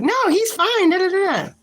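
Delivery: high, loud sing-song mock of a reader; high mocking sing-song brush-off; mocking other readers. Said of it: "these reads people give like".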